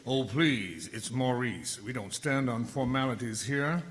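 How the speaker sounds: smooth Southern host; Southern accent. Acted. Lilting Southern accent named line 86.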